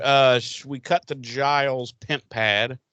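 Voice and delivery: normal talking voice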